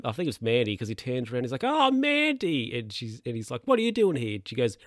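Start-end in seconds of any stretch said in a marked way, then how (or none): 1.6-2.8 high, drawn-out startled "Mandy"; caught-out surprise. Retold or imitated. Caught out by his ex. 3.7-4.4 startled question. Retold or imitated. Caught out by his ex.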